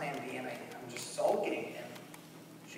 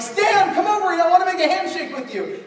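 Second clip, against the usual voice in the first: much higher, louder calling out; calling Stan over, much higher and louder; eager calling; 14 semitones higher, 12 dB louder than usual. Retold or imitated. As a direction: beckon him over eagerly. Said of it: "so I'm like".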